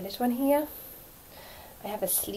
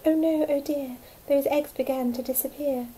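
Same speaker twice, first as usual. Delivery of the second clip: ordinary delivery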